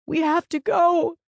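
high, loud, panicked sob; frantic crying voice; sobbing. A narrator's reading. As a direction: plead tearfully to get away. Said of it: "she sobbed".

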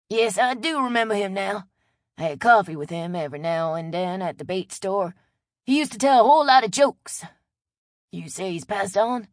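put-on friendly old local, loud and chatty; a neighborly Louisiana old-timer talking up a stranger; old Cajun man Chet.

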